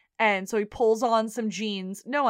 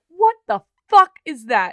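shocked yell; 7 semitones higher, 11 dB louder than usual. Retold or imitated. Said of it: "He's like".

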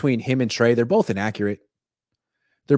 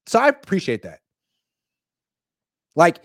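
ordinary talk, nothing marked

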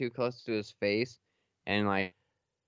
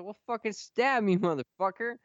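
daring yell; 9 semitones higher than usual. Retold or imitated. Retold dare to a coworker.

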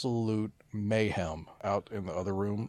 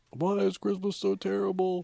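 put-on much higher wailing boy; whiny kid; 11 semitones higher than usual. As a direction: wail it like a heartbroken child. Quoting crying son.

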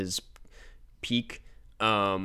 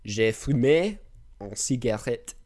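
foreign accent in French. Real, not acted. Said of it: "Wow, you're so good at French".

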